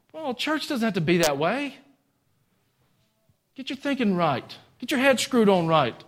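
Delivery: high, emphatic preacher's exhortation; full-force preaching. Real, not acted. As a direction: drive each command home from the pulpit.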